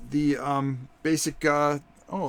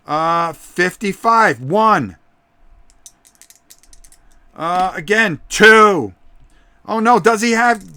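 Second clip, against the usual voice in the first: louder, higher ringside shouting; wrestling commentator; 9 semitones higher, 12 dB louder than usual. Acted. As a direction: yell the count like a hyped announcer. Near fall called at full volume.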